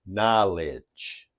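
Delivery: "knowledge" as a drawn-out "NAH-lidge"; accurate pronunciation. Shown as a demonstration. Teacher gives the experts' accepted pronunciation, "knowledge" as slow "NAH-lidge". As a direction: softly stretch "knowledge", stress on "NAH", falling on "lidge". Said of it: "is pronounced accurately as".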